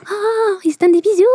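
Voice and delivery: put-on childish squeal. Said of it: "j'étais comme".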